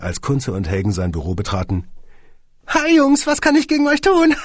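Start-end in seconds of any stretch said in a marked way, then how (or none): 2.7-4.3 very high, squeaky tiny boss voice; squeaky little man. Acted. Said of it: "piepste das Männchen".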